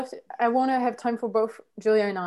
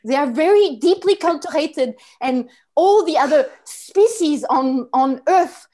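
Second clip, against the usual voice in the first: put-on haughty, louder French-accented rant; French anger; 3 semitones higher, 5 dB louder than usual. French person lectures the world on culture, indignant French anger. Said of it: "go into this French anger".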